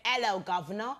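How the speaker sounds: attempted British accent. Shown as a demonstration. A Nigerian woman trying out British on the panel, British accent. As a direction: put on a British greeting. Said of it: "People think you're British".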